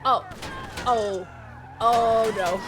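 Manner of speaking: loud startled yells; shouting. Real, not acted. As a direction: shout out in alarm. Car stuck, streamer yells.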